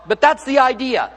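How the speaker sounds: normal talking voice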